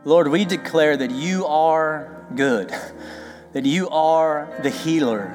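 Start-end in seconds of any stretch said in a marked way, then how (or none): none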